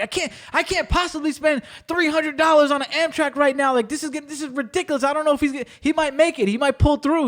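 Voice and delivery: high, overacted fretting; hammed-up fake distress; over-the-top panic. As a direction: ham up the worried friend on the phone. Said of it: "your acting skills were a little over the top".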